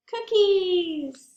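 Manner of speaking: high, loud cheerful shout; excited. Real, not acted. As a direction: announce it with glee. Answering her own question excitedly.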